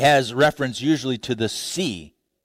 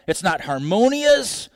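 ordinary talk

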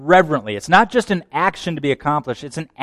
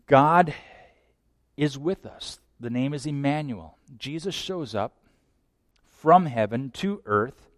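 ordinary delivery

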